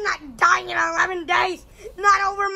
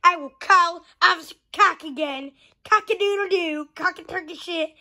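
ordinary talk, nothing marked

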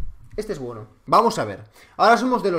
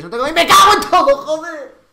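screaming curse, very high and loud; furious yell; 9 semitones higher, 8 dB louder than usual. Real, not acted. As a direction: lose it completely at the game. Raging after dying in game.

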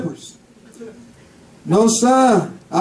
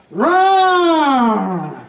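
lion roar. Acted. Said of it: "And he would be like".

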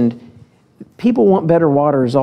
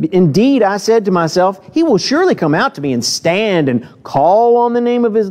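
measured, slightly raised Bible reading voice; scripture reading; 4 semitones higher than usual. Acted. Preacher reading Second Kings to the church, measured and raised. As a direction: read the passage aloud to the congregation.

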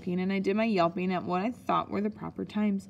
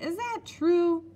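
high, drawn-out astonished question; astonished cry; 10 semitones higher, 4 dB louder, slower than usual. A narrator's reading. Child asks Nanny about the spies' toothbrushes, amazed cry. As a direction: stretch it out, pitched well up. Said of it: "I cried".

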